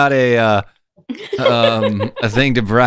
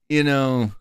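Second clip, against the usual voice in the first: ordinary talk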